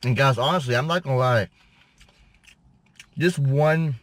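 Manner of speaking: chewing between words; eating pizza while talking; mouth partly full. Real, not acted. Seen 3: holds pizza slice, closed-lip chewing-like movements between words.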